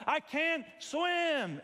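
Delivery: high, loud, drawn-out shout; drowning cry. Pretend. Drowning swimmer screaming to lifeguard, high slow shout. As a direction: cry out like you're going under.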